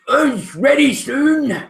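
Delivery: high, slow ogre promise. Acted. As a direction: drag out ready soon like a nervous ogre. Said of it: "the ogre looks up and says".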